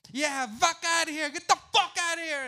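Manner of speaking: high, loud dismissal; hostile shout. Retold or imitated. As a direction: run him out of the stadium. Rival fans chasing him off.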